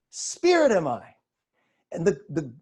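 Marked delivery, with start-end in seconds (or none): 0.1-1.1 SPIRIT stressed, then falling to the end; statement, not question. Shown as a demonstration. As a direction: declare "Spirit am I" firmly with falling tune. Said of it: "Not "Spirit am I?".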